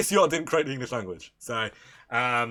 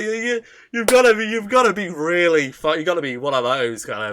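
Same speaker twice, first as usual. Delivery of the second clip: loud rant; 4 semitones higher, 9 dB louder than usual. Real, not acted. Ranting louder.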